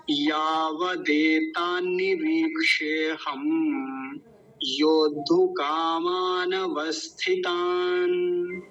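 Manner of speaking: "यावदेतान्निरीक्षे हम" very slow, final m held; careful Sanskrit recitation. Shown as a demonstration. Teacher reviews the big words, slow syllable-by-syllable demonstration. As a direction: sound out "योद्धु कामान वस्थितान" slowly, long vowels stretched. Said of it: "फिर से देखिए".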